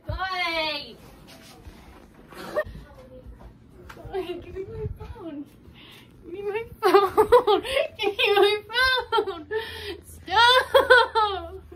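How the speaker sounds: high, loud shrieking laugh; laughing shout. Real, not acted. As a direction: scream it while laughing.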